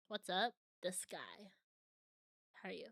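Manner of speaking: normal talking voice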